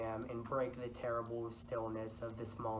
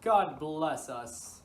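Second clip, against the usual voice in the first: loud, slow, higher cry of God bless us; servant's cry; 5 semitones higher, 11 dB louder, much slower than usual. Retold or imitated. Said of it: "One servant cried".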